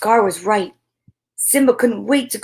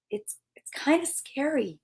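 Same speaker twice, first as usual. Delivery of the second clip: whispered; 5 semitones higher, 10 dB quieter than usual. Acted. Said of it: "Nala whispered".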